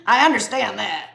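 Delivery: loud, exaggerated put-on voice; hammed-up insistence. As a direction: belt it out as a joke. Repeats line in exaggerated jokey voice.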